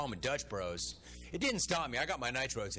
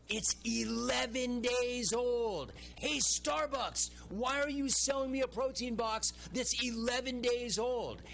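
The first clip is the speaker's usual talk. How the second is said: higher, slow, outraged disbelief; exasperated rant; 8 semitones higher, slower than usual. Real, not acted. Host rants at Starbucks over a stale box, outraged. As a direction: drag out every word in disbelief.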